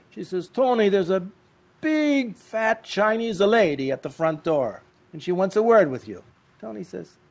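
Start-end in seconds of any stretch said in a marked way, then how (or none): 0.5-6.2 fussing wife. Retold or imitated. Wife telling Tony about the visitor at the door, high and fussy. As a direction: announce the odd visitor urgently.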